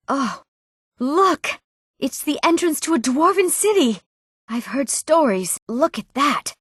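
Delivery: high, wonder-struck exclamations; awed excitement. Acted. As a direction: marvel aloud at a thrilling discovery. Exclaiming at discoveries while exploring.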